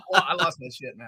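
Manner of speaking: normal talking voice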